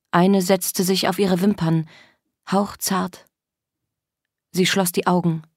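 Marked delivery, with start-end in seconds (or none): none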